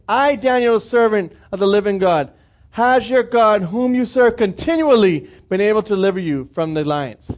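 ordinary talk

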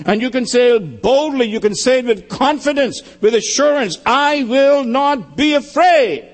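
preaching at full force. Real, not acted. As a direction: drive the assurance home. Sermon climax builds.

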